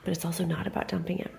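quick conspiratorial whisper. Acted. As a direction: hurry it under your breath. Aside contradicting herself.

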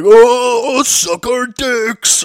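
loud, high drawn-out mock yell; rowdy high school kids. High school kids yelling at the food court, rowdy mock shout. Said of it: "group of high school kids".

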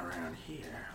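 ordinary talk

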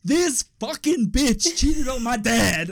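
high, loud, slow mock outrage; cranky outburst. Son finds out mum cheated on dad, hammed-up cranky outburst. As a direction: spit it out slowly, acting furious. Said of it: "I was so cranky".

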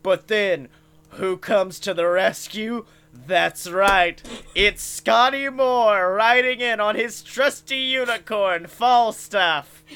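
loud, rising trailer-voice build; loud triumphant announcer voice; heroic announcer. Acted. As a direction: hype up the rescuer's arrival.

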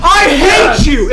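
furious shout. Real, not acted. Losing team yells at winner.

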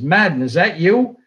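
calling out down the hall, half-hearted yell; retold yell. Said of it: "he yells at me".

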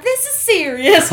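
high, slow Southern belle scolding. Retold or imitated. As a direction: insist like an affronted Southern belle. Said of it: "a weird Southern belle".

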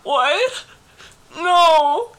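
put-on high, pleading baby whine; whiny baby voice. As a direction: beg like a hurt child. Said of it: "the proper Tate, like, baby voice".

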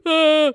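sharp gasp into a loud high "ah"; re-enacted cringe noise; cringing gasp. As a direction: recoil audibly in mock disgust. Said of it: "parts that I cringed at".

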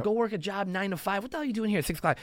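acted-out dismissive naysayer; dismissive heckling. Voices the world mocking early riser.

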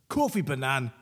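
loud, declaimed mock Scottish; mock Scottish accent. Co-host ending a listener's hate poem, mock Scottish accent. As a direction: land the closing insult in a joke Scottish accent. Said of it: "offensive Scottish accent now".

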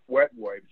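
"wet wipes" jumps high and loud; emphatic warning. Real, not acted. Telling the stream audience never to flush wet wipes, sharp and high. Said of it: "don't flush warning".

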